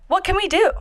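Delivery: high, bright helpful voice; chirpy phone-agent question; customer service voice. Retold or imitated. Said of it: "What was that word you used earlier?".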